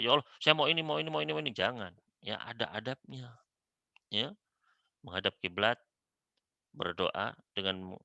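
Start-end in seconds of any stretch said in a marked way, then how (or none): 0.0-1.5 higher, louder list of demands, rushed and greedy; mock pushy prayer; mock greedy demanding prayer. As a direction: rattle off wants like a grabby child. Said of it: "jangan langsung blus-blus langsung doa".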